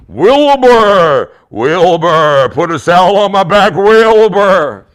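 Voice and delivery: loud drawn-out horse voice calling Wilbur; hammed-up talking horse, loud and stretched. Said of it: "And he had this deep voice".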